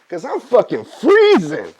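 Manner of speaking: loud, high punchline yell; exasperated outburst. Acted. Freezing cub answering his dad, loud exasperated yell.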